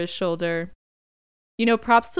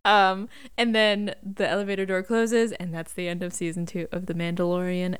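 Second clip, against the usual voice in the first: ordinary talk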